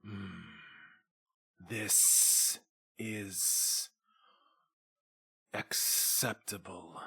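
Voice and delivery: low, slow, hushed and drawn-out; slow creature voice. Acted. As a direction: speak like a huge old beast weighing every word. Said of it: "Trapjaw slowly blinks his large, wet eyes and says".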